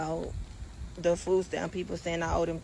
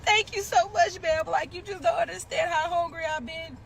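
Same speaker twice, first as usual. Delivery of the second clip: higher, sweet fake thanks; lightly put-on grateful voice; mock grateful; 9 semitones higher than usual. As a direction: gush like a starving victim. Acted grateful plea.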